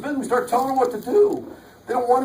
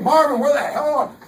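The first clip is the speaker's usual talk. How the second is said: mock angry driver; 6 semitones higher, 4 dB louder than usual. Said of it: "choleric driven personality".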